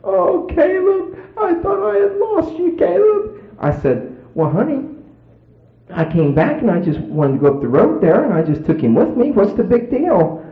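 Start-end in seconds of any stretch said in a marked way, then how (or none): 0.0-3.4 high, wailing put-on sob; hammed-up, very high tearful cry; mock crying her eyes out. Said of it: "crying her eyes out". 4.3-10.4 high-pitched, put-on innocent husband; imitated what's-the-fuss protest; feigned innocence. Husband feigning innocence to crying wife.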